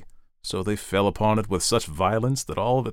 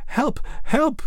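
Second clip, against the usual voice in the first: high, loud cries for help; crying for help; 3 dB louder than usual. Acted. Young ladies calling out as the tree blazes, high and loud. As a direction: shriek it as the fire catches. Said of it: "cried the young ladies".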